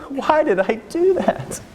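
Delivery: high, slow, dismayed self-question; mock regret. Parent regretting what they said in anger, dismayed. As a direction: ask it in exaggerated dismay. Regret after temporary insanity.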